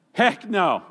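second "Heck no" pushed up with force, stress on "Heck"; emphatic Texan "heck no". Pretend. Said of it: "We would translate it in Texan".

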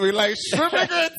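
pushed up playfully on the repeat; playful emphasis. Shown as a demonstration. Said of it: "repeated with more emphasis".

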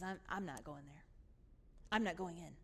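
low, soft, sullen refusal; anger held right down; quiet anger. Acted. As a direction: refuse under your breath, low and angry.

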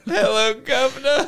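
high, hammed-up British greeting; mock British accent. Host echoing cohost's catchphrase, high mock British accent. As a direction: squeal a caricature British hello. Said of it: "can you do a British".

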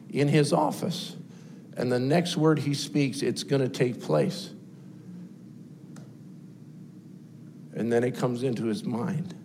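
very soft and low, long pauses; hushed sermon climax. Acted. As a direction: hold them in suspense, barely above a breath. Hushed climax before release.

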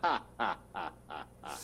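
robot laugh. Acted. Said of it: "No more monotone".

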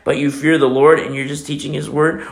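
ordinary delivery

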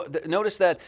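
ordinary talk, nothing marked

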